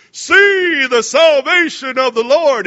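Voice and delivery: slow, loud proclamation to the people; booming Moses. Acted. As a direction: thunder it out like a prophet parting the sea. Preacher booms Moses' line.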